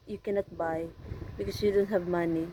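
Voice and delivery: normal talking voice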